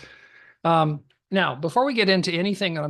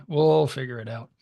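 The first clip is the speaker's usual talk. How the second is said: ordinary talk, nothing marked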